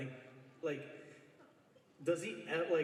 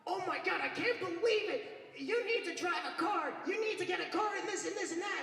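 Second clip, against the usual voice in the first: much higher, shouted mock tirade; Chi-Chi yelling; 13 semitones higher than usual. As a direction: rant at your husband like a nagging wife. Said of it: "she's like".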